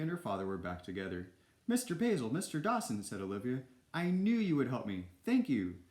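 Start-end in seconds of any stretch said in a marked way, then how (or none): 1.7-3.0 high, eager calling; acted girlish delight, pitched up; excited girl. Girl thanks her rescuers in story. 3.9-5.8 put-on high, glad little-girl gratitude; acted delight, lifting on the first phrase; excited thanks. Girl thanks her rescuers in story.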